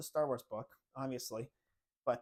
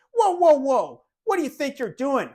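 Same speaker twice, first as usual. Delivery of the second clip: loud, high, stern father shouting; dad yelling; 12 semitones higher, 17 dB louder than usual. Retold or imitated. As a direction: bark it like an outraged dad.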